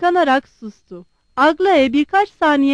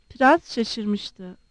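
usual speaking voice

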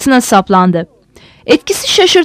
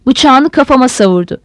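ordinary delivery

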